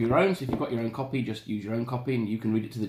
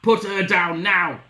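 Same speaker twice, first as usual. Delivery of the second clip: slow, loud, raised woodcutter command; bellow; 7 semitones higher, 7 dB louder, slower than usual. Acted. Woodcutter ordering the wolf, loud slow bellow. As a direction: bellow each word as an order. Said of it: "bellowed the wolf cutter".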